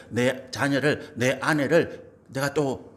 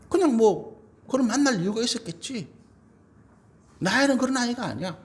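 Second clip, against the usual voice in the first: emphatic defense; 8 semitones higher than usual. Acted. Spouse defending partner emphatically.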